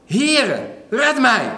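high, loud cry for help. Retold or imitated. Peter calling out to Jesus as he sinks, loud high cry. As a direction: Peter sinking, shouting to the Lord for rescue. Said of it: "Toen riep hij".

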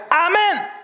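a high, loud proclaimed amen; ringing shout of amen; Levites crying out. Retold or imitated. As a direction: call it out like a crowd of Levites. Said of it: "De Levieten riepen uit".

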